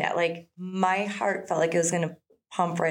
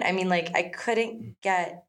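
ordinary delivery

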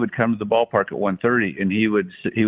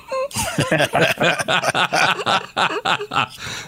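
long, high-pitched real laughter; sustained amused laughing fit; high extended laugh; 10 semitones higher than usual. Real, not acted. Laughs at the third-person joke.

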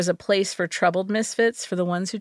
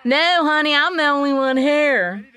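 put-on syrupy Southern accent; 6 semitones higher than usual. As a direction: coo it in an over-the-top Southern accent. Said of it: "a syrupy and exaggerated Southern accent drawls".

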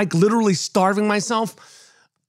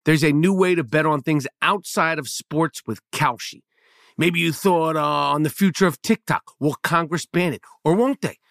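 lower, measured sponsor-read voice; 3 semitones lower than usual. Acted. Host pitching Kalshi betting to listeners, scripted ad read. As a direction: read the copy evenly, pitched a bit down.

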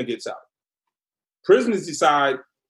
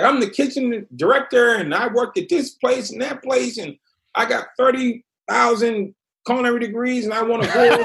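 high-pitched imitation of a blustering kitchen director; indignant kitchen boss; 6 semitones higher than usual. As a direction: reel off his credentials in a raised, mocking pitch. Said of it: "starts yelling at me".

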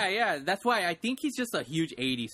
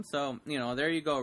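usual speaking voice

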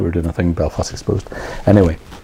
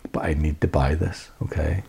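whispering; 4 semitones lower, 7 dB quieter than usual. Retold or imitated. Confiding the secret purchase.

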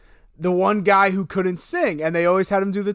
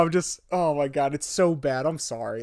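usual speaking voice

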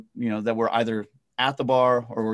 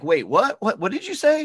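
mock confused prospect; 8 semitones higher, 4 dB louder than usual. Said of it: "they're like".

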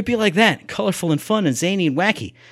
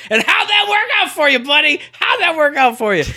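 very high, loud jeer; taunting yell; 11 semitones higher, 5 dB louder than usual. Pretend. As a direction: holler a gloating heckle at your buddy. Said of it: "I was gonna yell out".

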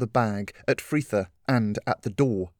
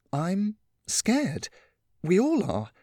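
heartfelt Catherine; 6 semitones higher than usual. Acted. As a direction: open up honestly to a frightened girl. Catherine's long heartfelt speech.

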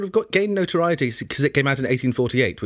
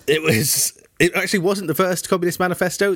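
ordinary delivery

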